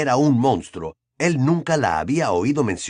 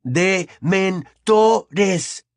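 "Dementores" split into slow, raised syllables; Harry, slow and clear; 9 semitones higher, 5 dB louder, much slower than usual. A narrator's reading. Harry repeats it for his uncle, slow and clear. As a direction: spell out "Dementores" syllable by syllable, louder. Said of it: "pronunciando con lentitud y claridad".

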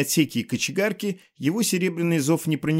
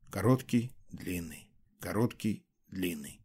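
fading whisper; 6 semitones lower, 9 dB quieter than usual. A narrator's reading. Signal rhythm fading.

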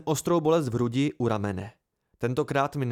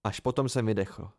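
ordinary delivery

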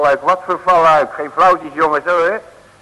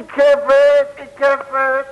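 higher, louder, slow triumphant cry; excited eureka; 11 semitones higher, 6 dB louder, slower than usual. Acted. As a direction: shout it as it dawns. Triumphant realization.

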